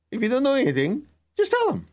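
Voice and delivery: the teacher reassuring him, in a high lively voice; teacher's advice. Retold or imitated. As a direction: bounce it up brightly. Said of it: "as the teacher reminded me".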